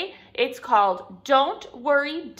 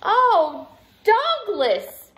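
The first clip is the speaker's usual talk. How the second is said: high, loud, falling exclamation; exclaiming mom; 11 semitones higher, 9 dB louder, much slower than usual. A narrator's reading. Mom seeing bedraggled Douglas come home, high dismayed voice. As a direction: cry out the name in dismay. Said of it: "said his mom".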